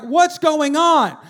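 high, loud, drawn-out yell; screaming kids. Retold or imitated. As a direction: imitate alarmed kids screaming back. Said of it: "They're screaming".

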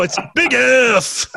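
slow booming rally announcer call; monster truck announcer. Pretend. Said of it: "you gotta say it like you're announcing a monster truck rally".

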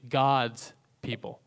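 "God's people" said slowly, each word weighted; slow, deliberate stress on "God's people"; emphatic. Real, not acted. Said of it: "let me say it emphatically".

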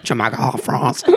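soft mock French accent; quietly hammed French accent. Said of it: "really terrible French accent".

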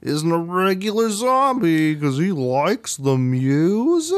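sing-song mockery, stretching the last word high and long; milk the silly reveal, rising at the end; mocking drawn-out reveal. Co-host ridiculing the zombie reveal, mock singsong.

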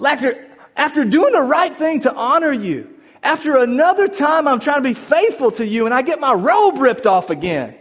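loud, high-pitched disbelieving complaint to God; acted outburst of indignant frustration; exasperated protest. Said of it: "he has got to be like".

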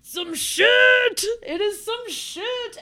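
shouted high and drawn out; loud exclaim. Real, not acted. Telling the friend how haunted it was, shouting. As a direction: belt it out in disbelief. Climax of the ghost story.

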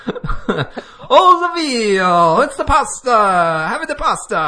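high, loud hammed-up Italian chef; Italian chef impression.

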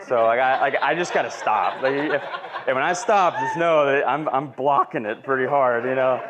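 real tearful laughing catch in the voice; choking up. Real, not acted. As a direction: fight the tears while still talking. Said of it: "I'm not, like, one of those criers".

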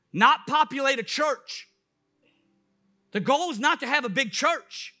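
high, loud pulpit delivery; full-force preaching. Acted. Pastor urging believers to populate heaven, impassioned preaching. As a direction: drive each phrase home to the congregation.

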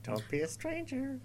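high, soft put-on voice. Host echoing the suggested podcast sign-off, high and soft. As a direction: try it high and quiet.

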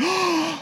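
high-pitched imitated gasp of dismay; horrified gasp. Daughter realizes which picture she broke, horrified gasp.

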